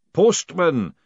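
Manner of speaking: loud ceremonial summons; commanding ritual call; booming demand. A narrator's reading. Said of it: "the booming voice demanded again".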